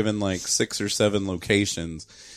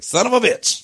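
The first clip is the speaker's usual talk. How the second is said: mock outburst; 13 semitones higher, 5 dB louder than usual. Retold discovery outburst.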